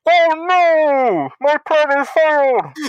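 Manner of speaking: high, loud, slow hammed-up lament; melodramatic villain. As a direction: wail it like a foiled cartoon villain. Villain's plan foiled.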